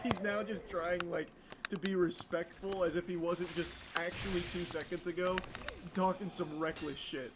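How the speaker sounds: usual speaking voice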